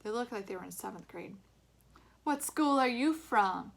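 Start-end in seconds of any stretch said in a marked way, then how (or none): 2.2-3.7 slow, loud call across the dark. A narrator's reading. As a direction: call out to strangers slowly. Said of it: "one of the boys called out".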